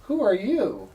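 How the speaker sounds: mild stagey challenge; dramatic reenactment. Acted. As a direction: ham up a confrontation a little. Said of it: "Here's a dramatic reenactment".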